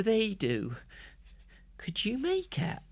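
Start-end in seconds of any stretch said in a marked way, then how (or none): 1.8-2.8 hushed, unvoiced whisper. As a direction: whisper so the guards won't hear. Hushed question to captive.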